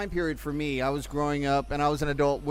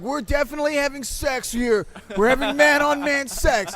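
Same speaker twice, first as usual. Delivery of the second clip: excited insistence; 8 semitones higher, 5 dB louder than usual. Retold or imitated. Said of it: "And he's like".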